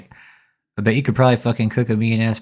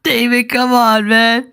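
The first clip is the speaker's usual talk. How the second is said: exasperated disbelief; 11 semitones higher, 3 dB louder, slower than usual. Real, not acted. Mocking the preacher's claim.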